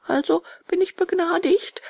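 high, thin puppet question; thin little voice. A narrator's reading. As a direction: ask high and timidly, voice thinned. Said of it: "mit kaum hörbarem, dünnen Stimmchen". (dünnes, hohes Stimmchen)

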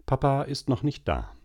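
ordinary delivery

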